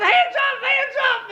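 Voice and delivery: very high strained yell; first officer screaming at the driver, frantic. Retold or imitated. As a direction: scream it in panic. Officer yells.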